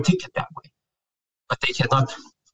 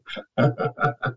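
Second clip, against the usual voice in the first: soft laugh; 5 dB quieter than usual. Real, not acted. Laughs after venting.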